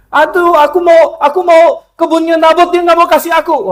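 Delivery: put-on high, loud childish whine; sulking king. King Ahab whining to his wife over a vineyard. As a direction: whine like a spoiled child denied a toy. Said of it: "Ahab ngambek".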